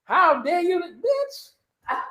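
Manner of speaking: high, hushed mock indignation; offended coworkers. Coworkers scold him for leaving, high hushed mock voice. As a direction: play the scandalized coworker. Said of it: "they was mad at me like".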